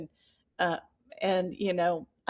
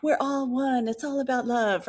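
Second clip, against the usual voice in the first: light, much higher mock dreamy voice; mock hippie; 7 semitones higher than usual. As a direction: gently parody a mushroom trip insight. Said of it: "people do mushrooms and they're like".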